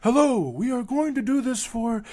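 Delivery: a hint of a polished public speech; mock politician. Lightly mocking politicians' smooth common-good talk. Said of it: "they don't".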